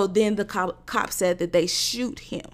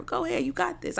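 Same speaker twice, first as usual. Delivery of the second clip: higher, soft encouragement; encouraging mom; 10 semitones higher, 4 dB quieter than usual. Retold or imitated. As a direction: cheer him on gently. Reassuring son driving.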